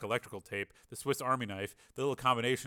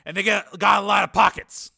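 loud, higher-pitched salesman-like patter; brash reporter's cover story; Steele bluffing breezily; 9 semitones higher, 12 dB louder than usual. Acted. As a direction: explain it away loudly and casually. Narrator voices Steele bluffing the sergeant.

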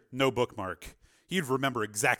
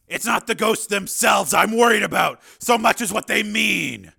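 loud, raised, worried character voice; worried Steele; 9 semitones higher, 10 dB louder than usual. A narrator's reading. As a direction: voice the worry high and loud.

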